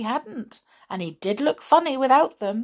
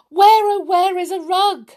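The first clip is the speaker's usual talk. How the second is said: high, loud frantic call; frightened doll searching for a rug; panicked; 9 semitones higher, 7 dB louder than usual. Acted. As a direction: cry out desperately for help. Panicked search during the fire.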